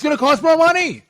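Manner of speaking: high, loud outburst after a whisper; sudden shout. Acted. Host mocking the president on washing machine costs, sudden shout.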